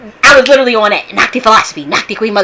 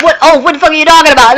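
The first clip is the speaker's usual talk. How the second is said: higher and louder, half-mocking her own disbelief; incredulous outburst; 7 semitones higher, 5 dB louder, faster than usual. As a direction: replay your stunned "what oath?" to the caller. Retold own stunned reply to caller.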